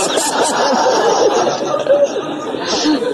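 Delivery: high giggling fit; real laughter up high. Real, not acted. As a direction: crack up completely. Laughing at own story.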